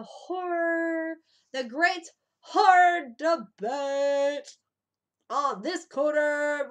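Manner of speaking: slow, loud ring announcer voice; announcer hype. Acted. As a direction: boom it out like a fight announcer.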